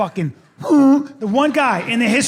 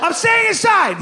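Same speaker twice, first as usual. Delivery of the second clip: mock scared refusal; 11 semitones higher, slower than usual. Imitates kids scared of going out.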